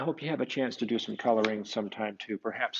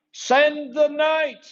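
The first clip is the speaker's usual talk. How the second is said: much higher, loud, slow collective shout; crowd shouting; 11 dB louder, much slower than usual. Acted. Townsfolk shouting at Francis, loud and high. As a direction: yell it as a whole crowd demanding. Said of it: "everyone shouted".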